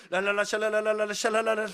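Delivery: loud, rattling monotone babble; mock speaking in tongues. Boy hears strange prayer for first time, loud mimicked tongues. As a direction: rattle off syllables in a frenzied drone. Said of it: "난생 처음 듣는 소리가".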